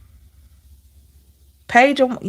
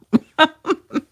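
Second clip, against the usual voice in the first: light laugh; 8 semitones higher than usual. Real, not acted. Laughing about scolding mom.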